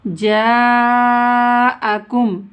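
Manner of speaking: जाअकुम with a greatly stretched first "jaa"; long madd. Shown as a demonstration. Teacher showing the madd sign, long-drawn aa in जाअकुम. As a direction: chant जाअकुम, holding the aa very long. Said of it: "ज़्यादा खींच कर पढ़ेंगे".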